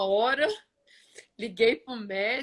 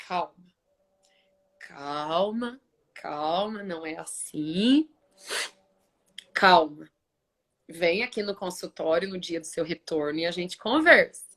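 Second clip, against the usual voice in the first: measured, lower-pitched reassurance; retold calming doctor; 3 semitones lower than usual. Doctor tells patient to wait for her appointment, calming. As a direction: rein in an overexcited patient, voicing the doctor.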